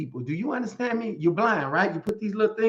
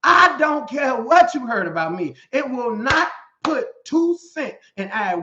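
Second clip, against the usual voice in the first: loud, high and defiant; preacher shouting down the rumor mill; shouted defiance; 6 semitones higher, 4 dB louder, slower than usual. Real, not acted. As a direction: shout it back at the gossips. Preacher answers gossip loudly.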